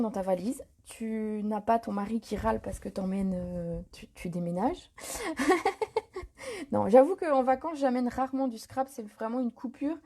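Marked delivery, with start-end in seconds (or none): 4.9-6.7 a short real laugh at her own joke; amused laugh. Real, not acted. Crafter laughing at her husband joke, real laugh. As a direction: let a bright laugh slip out.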